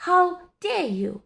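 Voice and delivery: high, loud and slow, outraged; furious old lady drenched by water; angry shout. A narrator's reading. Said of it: "she shouted".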